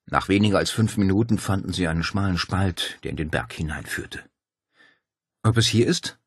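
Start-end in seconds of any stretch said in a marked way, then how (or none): 5.4-6.2 leicht angehobene, zweifelnde Frage; zweifelnd. A narrator's reading. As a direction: mit einem Hauch Skepsis fragen. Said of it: "fragte Claudia zweifelnd". (zweifelnd fragend)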